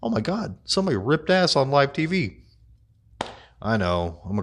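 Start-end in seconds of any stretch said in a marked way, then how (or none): 0.0-2.4 rising, amused disbelief; can't believe what he just heard; amazed disbelief. Retold or imitated. To viewers about the fart on live TV, amused disbelief. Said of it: "I'm like".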